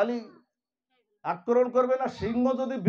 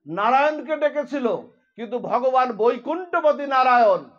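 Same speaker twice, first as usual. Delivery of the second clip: raised preaching voice calling out the name; fervent sermon telling; 4 semitones higher, 6 dB louder than usual. Acted. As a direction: tell the story with rising fervour. Tells Ajamil story to devotees. (উদ্দীপ্ত কণ্ঠে কথকতা)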